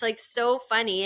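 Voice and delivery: ordinary delivery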